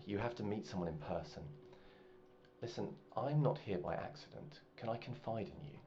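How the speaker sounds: a noble woman whispering a secret offer; hushed confiding woman. Acted. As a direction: lean in and confide quietly. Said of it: "and she says".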